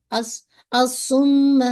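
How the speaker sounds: الصم with the doubled م held long and nasal; held shadda on meem. Shown as a demonstration. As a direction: hold the م in الصم for a full ghunnah. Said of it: "میم پہ آپ کو دیکھیے شد ہے، تو آپ نے اس کو ہولڈ کرنا ہے". (الصم میں مشدد میم کو غنہ کے ساتھ روک کر)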